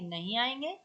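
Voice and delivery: "नहीं आएंगे" with a rising, questioning end; प्रश्नवाचक लहजा. Shown as a demonstration. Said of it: "अब ये क्वेश्चन मार्क लगा". ("नहीं आएंगे" पर सवालिया चढ़ाव)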